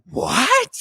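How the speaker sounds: sudden high squeak of surprise; shocked what. Retold or imitated. Fan seeing the twist reveal, shocked squeak. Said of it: "And you're like".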